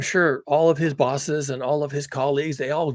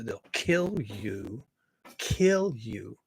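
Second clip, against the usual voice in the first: very slow, drawn-out words; grave warning; 3 semitones lower, 4 dB quieter, much slower than usual. Real, not acted. Speaker warning that denialists are dangerous, slow and grave. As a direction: stretch it out ominously.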